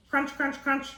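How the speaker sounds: high, crisp little crunches; crunching sound. A narrator's reading. Narrator voicing the bunny eating, high crisp crunches. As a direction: make the bunny's nibbles crunch.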